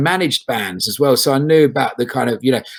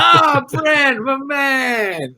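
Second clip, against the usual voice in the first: high, shouted buddy hail; bursting high-pitched cheer of a mate greeting a star; mock fan greeting; 12 semitones higher, much slower than usual. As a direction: hail him like an adoring fan. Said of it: "I reckon it's like".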